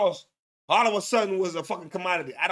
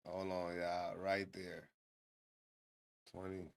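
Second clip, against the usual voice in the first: muttering quietly; 14 semitones lower, 17 dB quieter than usual. Real, not acted. Muttering to himself while scrubbing video.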